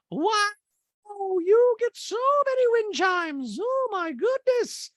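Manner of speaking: piercingly high, slow caricature; Dorit impersonation. Retold or imitated. Host mimics housewife marvelling at wind chimes, very high gushing. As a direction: gush it slowly in a hammed-up high voice. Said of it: "And Dorit goes".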